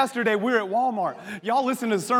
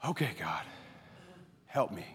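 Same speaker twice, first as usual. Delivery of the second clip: soft, lower, a breath of a prayer; hushed prayer; 11 semitones lower, 12 dB quieter than usual. Retold or imitated. Father steadies himself mid-crisis, hushed prayer to God. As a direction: pray under your breath to calm down.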